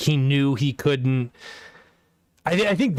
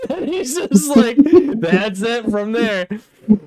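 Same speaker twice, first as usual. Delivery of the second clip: high, loud, through laughter; laughing while talking; 10 semitones higher, 6 dB louder, slower than usual. Real, not acted. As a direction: barely get it out for laughing. Cracking up while retelling.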